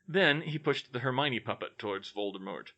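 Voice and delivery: ordinary delivery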